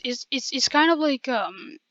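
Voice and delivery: ordinary delivery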